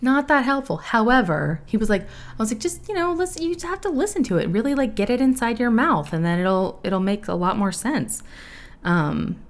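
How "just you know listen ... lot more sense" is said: much higher, fast, airy know-it-all; breezy mock advice. Imitating herself coaching the actor, high breezy mock. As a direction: toss off useless advice, lightly mocking yourself. Mocking her own bad coaching.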